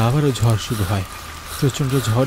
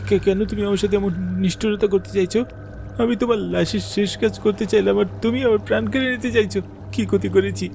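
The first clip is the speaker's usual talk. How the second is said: higher, stammering plea; frightened pleading; 8 semitones higher than usual. Acted. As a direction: ask why, terrified. Narrator pleads with ghost.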